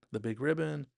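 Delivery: ordinary talk, nothing marked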